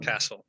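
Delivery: Castle said as kaso with no T; silent T. Shown as a demonstration. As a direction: drop the T in Castle. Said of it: "no se pronuncia".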